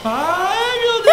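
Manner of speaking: loud high startled cry; yelling in fright; frightened yell. Real, not acted. Monster passes, fright.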